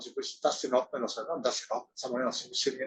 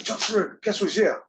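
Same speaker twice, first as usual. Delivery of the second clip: excited manager; 6 semitones higher, 5 dB louder than usual. Retold or imitated. Manager calling him excitedly.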